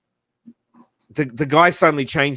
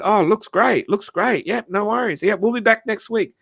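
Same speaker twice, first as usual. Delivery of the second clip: breezy wife; 8 semitones higher than usual. Retold or imitated. Neighbour's wife voiced, retold.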